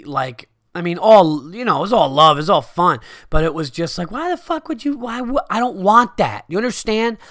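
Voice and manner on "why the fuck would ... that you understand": high, squealing mock rant; lifted high, exasperated mock shout; retold drunk yelling. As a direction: whine it like a drunk friend. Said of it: "we're just screaming at each other".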